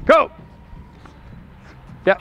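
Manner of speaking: ordinary delivery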